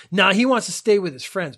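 shitty, dismissive. Retold or imitated. Old man refuses the seat offered to the boy, loud snide dismissal. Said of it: "in a really shitty way".

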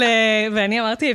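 normal talking voice

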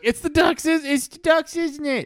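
put-on very high, loud kid voice; squealing little boy; excited kid. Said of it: "Jimmy was like".

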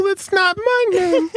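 high, slow squeaky imitation; mock your friend in a high squeak; friend impression. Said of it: "such a good impression of me".

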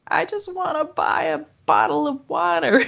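high, plaintive little plea; mock meek customer. Tourist to rude Canadian shopkeeper, meek plea.

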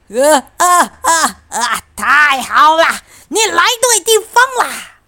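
loud, high, gloating glee; gleeful fox. Acted. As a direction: crow with delight over your catch. Fox gloats at caught piglet.

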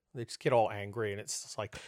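normal talking voice

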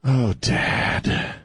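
mock exasperated daughter, sighing; a light sketch of an embarrassed daughter; imitating daughter. Dad imitating his daughter's exasperated sigh at his politics. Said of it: "she just kinda goes".

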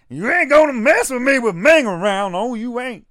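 loud, high Southern manager taunt; hammed-up Southern landowner hollering a warning; Colonel Robert Parker. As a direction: crow it like a Southern wrestling manager. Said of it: "he's always like".

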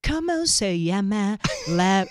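put-on slow, heavy American-accented Spanish; mock a gringo teacher's Spanish. Wife imitating the American teacher's gringo-accented Spanish. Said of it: "talking with a gringo accent".

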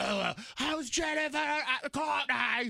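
hammed-up sneering call-out; snarky taunt. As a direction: shout it with sarcastic attitude. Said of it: "And I have an attitude".

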